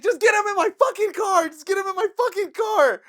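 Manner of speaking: high, loud repeated order; yelling orders at friends; frantic yelling. Retold or imitated. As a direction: shout the command twice, desperate. Ordering friends to move him.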